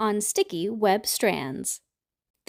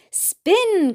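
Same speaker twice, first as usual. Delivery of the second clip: higher, louder call; 8 semitones higher, 5 dB louder than usual. A narrator's reading. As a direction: spider ma giving orders. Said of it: "called the ma".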